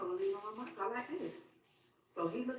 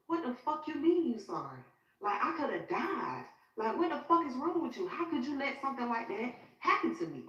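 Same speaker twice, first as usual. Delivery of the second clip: raised, higher-pitched retelling of a furious rant; replaying the blow-up at her friend, voice pitched up; angry outburst; 7 semitones higher than usual. In the jail video visit, yelling at her best friend in anger. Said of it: "So I'm stressing".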